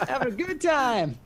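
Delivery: high voice through laughter; giddy, high, tailing-off laugh in the words; laughing glee. Acted. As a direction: say it while still cracking up. Laughing on after the joke.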